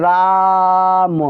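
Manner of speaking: राम stretched slowly, vowel held; drawn out. Shown as a demonstration. Teacher showing the extra-long vowel, drawn out. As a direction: drag out the आ in राम. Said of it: "बहुत देर तक आवाज़ करते हैं".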